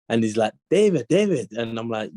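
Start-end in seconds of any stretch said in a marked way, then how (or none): 0.7-1.5 put-on calling out a name in a light Scottish accent; hint of a Scottish airport driver. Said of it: "This guy with the accent".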